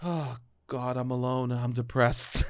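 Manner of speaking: acting out the depression stage of grief, softly; mock depression. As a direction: sink into fake despair. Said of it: "hit the fourth".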